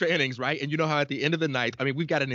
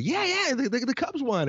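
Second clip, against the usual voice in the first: much higher, thrilled cry; excited; 12 semitones higher than usual. Retold or imitated. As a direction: gush it like you can't contain the news. Quoting himself to wife.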